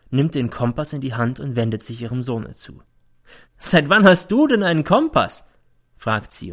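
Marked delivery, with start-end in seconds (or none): 3.6-5.4 high, loud scornful question; mocking. A narrator's reading. Mother mocking her son in court, high and scornful. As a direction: sneer the question at him. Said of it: "und es klingt wie Hohn".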